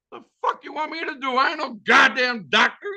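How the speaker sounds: high, fast exasperated yell; high, indignant shout at the wounded stranger; incredulous outburst. Retold or imitated. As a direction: snap back that you can't help him. Retorting to the shot man.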